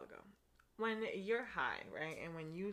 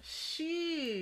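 mock offended; 10 semitones higher, 5 dB louder than usual. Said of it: "I'm sorry".

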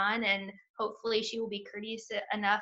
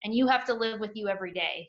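usual speaking voice

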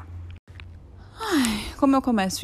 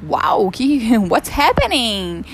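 mock shock; 3 semitones higher, 8 dB louder, much slower than usual. Mock shock at her bad day.

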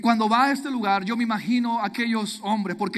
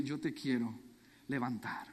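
soft, hushed and much quieter, like a gentle promise; tender whisper of God; 8 semitones lower, 11 dB quieter than usual. Acted. God gently promising the hurt listeners, hushed and tender. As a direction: lay the words down softly as God comforting.

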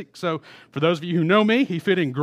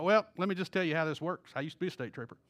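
[name] in state trooper mode; 2 semitones lower, 7 dB quieter, faster than usual. Retold or imitated. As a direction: explain it as a trooper would, pitched down and quiet. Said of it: "I kinda sound like Randy Pafford there".

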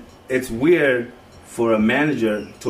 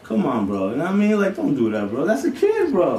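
heated high protest; 11 semitones higher than usual. Retold or imitated. Retelling telling off the manager.

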